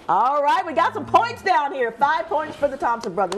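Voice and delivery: big game-show cheer; excited host. Real, not acted. Game show host cheering a matching answer, excited and loud.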